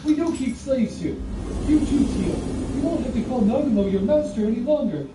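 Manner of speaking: high, loud, eager would-be rescuer; fired-up Kouki, pressuring. Acted. Kouki urging Hajime's girls to join him, eager and insistent. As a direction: press the invitation with loud, high-pitched zeal.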